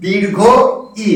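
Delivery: "দীর্ঘ ই" with a pause, then ই held long; long vowel. Shown as a demonstration. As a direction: say "দীর্ঘ ই", stretching the final ই. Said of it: "উচ্চারণের সময়টা বেশি লাগে". (থেমে, ই টেনে "দীর্ঘ ই")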